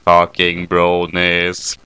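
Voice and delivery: a deep deadpan critter gloating; low smug creature saying I told you so; smug Kyubey voice. Acted.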